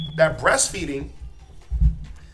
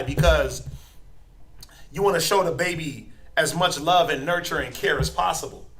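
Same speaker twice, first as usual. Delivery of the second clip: ordinary talk, nothing marked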